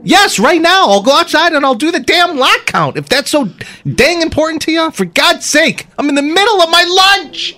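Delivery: high, loud, hammed-up Minnesota rant; angry car salesman. Salesman ranting at the questioner over lunch, angry and high. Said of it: "acting out a scene from the film Fargo".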